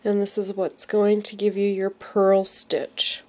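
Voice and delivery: ordinary talk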